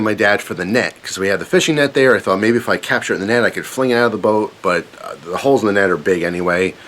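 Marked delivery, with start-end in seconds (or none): none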